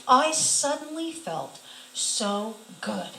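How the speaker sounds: slow, wondering emphasis on each word; savouring relief. Real, not acted. Woman recalls suddenly feeling good, slow and awed. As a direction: draw it out with spaces between words.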